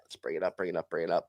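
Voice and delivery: low, soft repeated mumble; muttering to self. Real, not acted. Host to himself while pulling up the price, muttering. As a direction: murmur it while looking something up.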